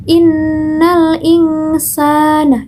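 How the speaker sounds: "insana" chanted slowly with a free-flowing s; soft letter articulation. Shown as a demonstration. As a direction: let air flow freely through the s in "insana". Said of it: "hurufnya lemah ya". ("insana" dilantunkan pelan, sin lemah)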